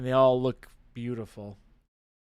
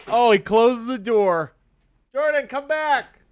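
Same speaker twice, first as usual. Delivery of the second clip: high, loud protesting yells; calling out; 8 semitones higher, 10 dB louder than usual. Real, not acted. Yelling after Jordan as he walks out the door. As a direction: shout after him in disbelief.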